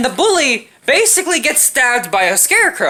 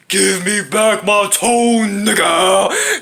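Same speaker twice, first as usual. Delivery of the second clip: slow, lightly voiced ghost demand; mock ghost voice; 3 semitones lower, much slower than usual. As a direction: drag it out like a hammy ghost. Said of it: "a fucking ghost saying".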